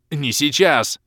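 loud high snap; barked. Acted. Hero cutting off the elf, loud bark. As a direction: bark it to cut him off. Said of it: "рявкнул я".

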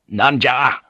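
curt, impatient Japanese husband. A narrator's reading. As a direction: demand an explanation, mildly impatient. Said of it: "Buntaro asked impatiently".

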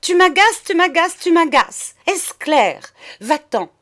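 high, loud, exasperated repetition; nervous rapid outburst. A narrator's reading. Madame dismissing monsieur, highly irritated. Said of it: "de plus en plus nerveuse, elle débita très vite".

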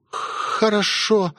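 высоко и очень медленно, дрожа от страха; выдавить сквозь трясущиеся губы; дрожащим шёпотом. A narrator's reading. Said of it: "трясущимися губами прошептала Элизабет". (испуганная Элизабет покорно соглашается с хозяином, медленно и дрожа)

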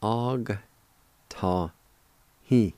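og, ta, hi, each set apart; syllable-by-syllable pronunciation. Shown as a demonstration. Teacher showing the G sound, og ta hi in separate syllables. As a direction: pause between og, ta and hi. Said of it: "pronounce the G sound ... like this".

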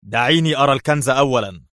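demanding to see the treasure first, sharply. Acted. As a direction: press her sharply. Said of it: "قال في حدة".

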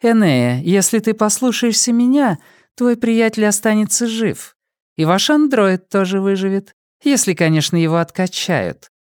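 high-pitched voice of a smiling villainess; smiling woman. A narrator's reading. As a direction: pitch it high, politely menacing with a smile. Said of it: "с улыбкой проговорила женщина". (высокий женский голос с улыбкой)

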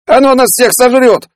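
very high-pitched terrified screaming; panicked yell. A narrator's reading. Peller screams about being eaten, panicked yell. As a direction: scream it like you are doomed. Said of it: "заорал Пеллер".

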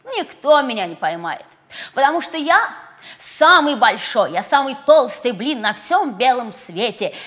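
loud bragging pancake; brag, proud and loud; boastful. Acted. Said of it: "и хвастал".

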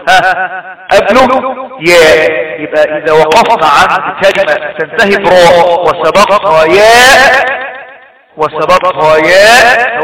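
ordinary talk, nothing marked

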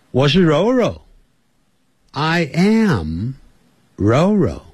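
ordinary talk

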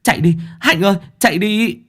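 high frantic yelling; panicked shouting. A narrator's reading. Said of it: "tiếng của một người khác".

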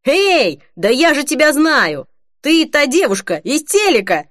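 high, animated outcry; excited yell. A narrator's reading. Mark recognises Holly from TV, excited yell. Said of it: "пригвоздил её к месту воплем".

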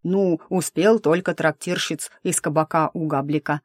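ordinary delivery, nothing marked

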